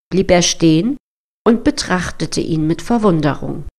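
plain reading voice

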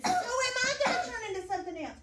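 high, loud, laughing pollywog. Acted. Teacher voices the pollywog's question high and laughing. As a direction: squeak it out with a laugh.

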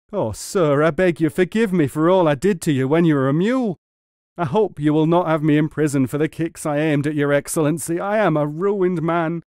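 high, loud pleading; panicked begging. A narrator's reading. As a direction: beg desperately for mercy. Frightened muleteer begging forgiveness.

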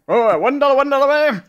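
lightly voiced high auctioneer call. Mimicking an auctioneer.

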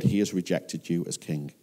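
ordinary talk, nothing marked

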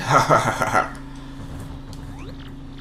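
short breathy villain chuckle; villain laugh. Acted. Boxer sneers at the rival with a breathy laugh. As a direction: snicker at the puny rival. Rock Hawk's threat to rival.

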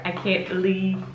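normal talking voice

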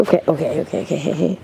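loud, urgent reassurance; urgent calming. Real, not acted. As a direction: cut through her panic. Calming panicking friend.

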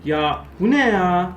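loud, slow exasperated cry; frustrated yell. Real, not acted. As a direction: yell at the game in disbelief. Frustrated outburst. (sinirli haykırış)

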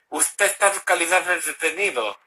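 lisped s in "Usted está", like th; imitating a lisp on every s. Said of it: "porque hablaba con ceseo".

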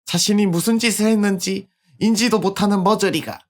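high, contemptuous threat; cold menacing girl. Acted. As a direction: spit the insult down at him.

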